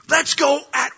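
high, loud, emphatic call to action; rallying cry. Acted. Acting Caleb urging the people, high loud rally. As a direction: shout the troops onward.

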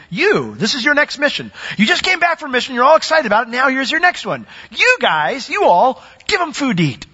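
high, ringing preacher's push; fired-up sermon delivery; preaching at full force. Acted. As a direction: press the charge on them like a preacher. Preacher drives point home.

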